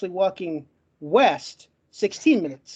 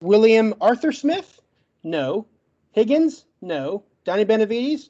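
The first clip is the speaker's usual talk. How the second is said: ordinary delivery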